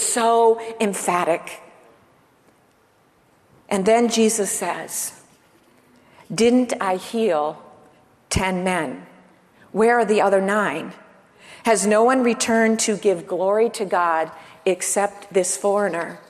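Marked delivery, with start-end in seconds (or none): none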